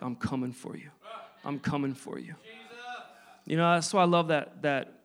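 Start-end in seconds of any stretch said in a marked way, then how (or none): 0.0-0.9 low, soft voice of God closing in; quiet and low, a promise that God is coming; God's voice. Retold or imitated. Preacher voicing God's quiet pursuit, low and soft. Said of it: "that God was like". 1.4-2.3 low, soft, set apart from the first take; God's voice, echoed. Retold or imitated. Preacher repeating God's call to him, low and hushed. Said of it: "that God was like".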